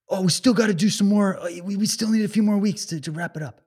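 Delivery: lightly mocking government spokesman; mock official voice. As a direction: brush it off like a smug bureaucrat. Acted dialogue, authorities speaking.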